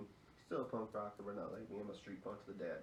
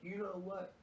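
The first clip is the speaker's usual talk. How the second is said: defiant taunt; 10 semitones higher, slower than usual. Retold or imitated. Defiant teen provoking gym teacher.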